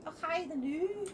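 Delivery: slow mock-Scottish catchphrase; Scottish accent. Co-host coaxing streamer to be Scottish, drawn-out Scottish accent. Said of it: "You can do Scottish".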